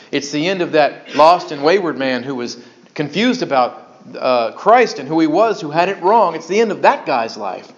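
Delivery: ordinary talk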